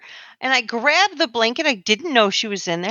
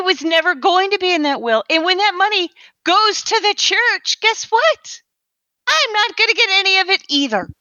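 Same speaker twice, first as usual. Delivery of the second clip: heated, high-pitched argument; exasperated; 6 semitones higher than usual. Acted. Woman cut out of the will argues with Emmanuel, exasperated. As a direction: drive the point home with pitch climbing.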